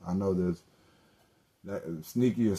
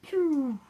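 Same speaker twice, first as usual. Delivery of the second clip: squeaky pew of diving away; high whoosh sound. Retold or imitated. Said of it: "Boy, I be like".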